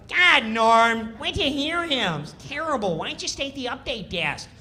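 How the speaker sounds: high, nasal-free mock whine of a needling castmate; whiny nitpicking castmate. Kattan nags Norm before the show, high mock whine. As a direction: needle him in a high, complaining voice. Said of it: "Kattan starts".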